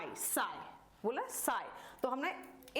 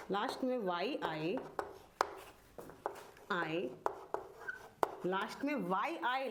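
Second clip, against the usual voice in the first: slow dictation; 2 semitones lower than usual. Shown as a demonstration. Teacher dictating the long E rule, slow dictation. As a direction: dictate word by word, leaving time to write.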